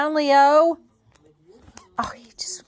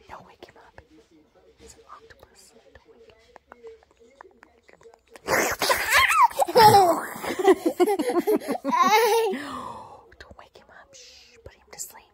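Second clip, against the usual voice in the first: whispering over a crib; 6 semitones higher than usual. Real, not acted. Hushing so baby stays asleep.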